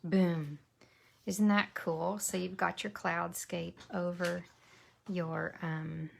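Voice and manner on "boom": usual speaking voice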